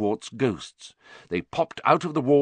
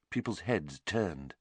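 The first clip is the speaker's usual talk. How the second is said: ordinary delivery, nothing marked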